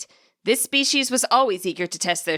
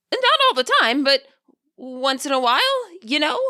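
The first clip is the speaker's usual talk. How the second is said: much higher, eager rising voice; eager spirit; 6 semitones higher than usual. A narrator's reading. Lino urging Indigo's village to share starters, eager and high. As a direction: pitch the idea hopefully.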